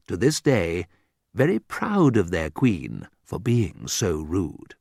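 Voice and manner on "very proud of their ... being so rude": plain reading voice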